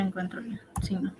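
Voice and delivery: murmura sin voz mientras busca; susurrado, como hablando sola; susurro para sí. Real, not acted. (susurro mientras busca el signo)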